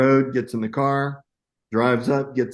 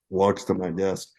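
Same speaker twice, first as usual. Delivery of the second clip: ordinary talk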